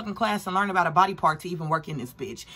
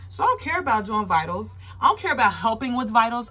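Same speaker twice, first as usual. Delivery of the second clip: ordinary talk, nothing marked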